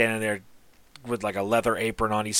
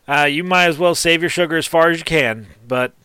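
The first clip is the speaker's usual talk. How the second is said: higher, louder teasing female gnome; sassy gnome smith; 5 semitones higher, 7 dB louder than usual. Acted. Gnome smith teasing Boomer about her name, higher and louder. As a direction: tease him coyly with a raised voice. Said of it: "and goes".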